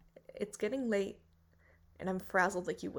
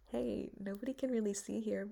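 ordinary talk, nothing marked

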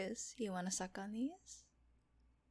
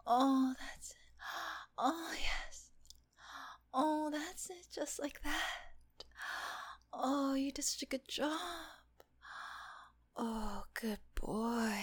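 higher, eager, partly whispered praise; breathless excitement; 4 semitones higher, slower than usual. Acted. Nurse cheering the patient on, excited breathy praise. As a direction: urge him on with rising excitement.

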